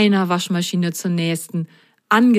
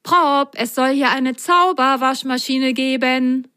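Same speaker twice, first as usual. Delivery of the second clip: higher-pitched, eager sock character; pitched-up, big announcement; chattering away; 6 semitones higher than usual. A narrator's reading. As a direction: share an exciting rumour as little Milo. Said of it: "redete Milo drauf los".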